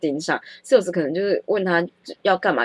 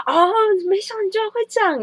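mock surprise; 13 semitones higher than usual. Salesman's surprised exclamation.

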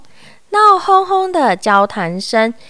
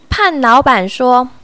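ordinary delivery

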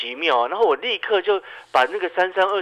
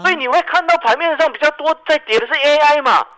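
animated explaining; 11 semitones higher, 5 dB louder than usual. Real, not acted.

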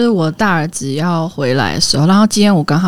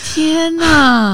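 shocked gasp; 8 semitones higher than usual. Real, not acted. Shocked at baby mess story.

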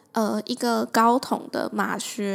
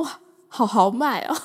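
amazed; 5 dB louder than usual. Retold or imitated. Impressed by bold boss.

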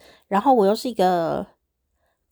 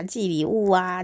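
ordinary talk, nothing marked

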